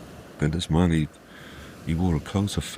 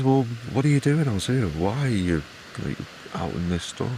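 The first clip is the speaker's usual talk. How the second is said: baffled questioning; 7 semitones higher than usual. A narrator's reading. Angus questions stranger out in storm.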